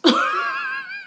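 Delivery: amused squeal of a laugh; high-pitched laugh. Real, not acted. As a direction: cackle up high, breaking off. Laughing at own rant.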